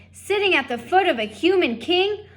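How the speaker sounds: high, loud, outraged rant; outraged shout. Acted. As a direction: bellow it in disbelief. Lester ranting at wife.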